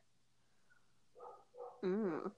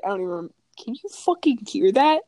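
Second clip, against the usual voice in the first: normal talking voice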